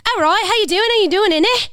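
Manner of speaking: Irish accent demo. Shown as a demonstration. Guest demonstrating their theory of the accent, Irish accent. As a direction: pitch it up like an Irish question. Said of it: "when I was doing the accent".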